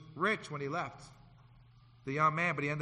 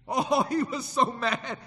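laughing; 6 semitones higher, 5 dB louder, slower than usual. Real, not acted. Laughs while telling.

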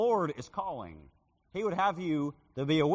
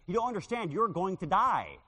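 insistent warning; 5 semitones higher, 5 dB louder than usual. Retold or imitated. Young man insists.

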